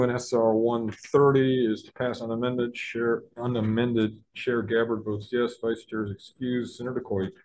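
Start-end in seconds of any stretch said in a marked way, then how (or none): none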